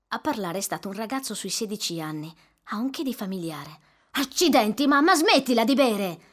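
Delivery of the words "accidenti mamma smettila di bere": louder angry rebuke; yelling. Acted. Teenage son yells at his drunk mother. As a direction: scold mother for drinking.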